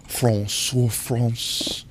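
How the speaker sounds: softly crooned mock-French accent; soft, swooning French parody. Streamer mocking French as the romantic language, soft mock-French accent.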